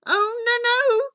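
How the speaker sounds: put-on high-pitched boy's protest; boy crying out. A narrator's reading. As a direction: cry out in eager protest. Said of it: "cried Jack".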